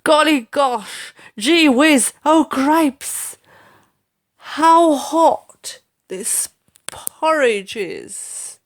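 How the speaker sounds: a fussy father scalded by porridge; slow, high comic outcry; Dad crying out. A narrator's reading. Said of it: "Then Dad cries".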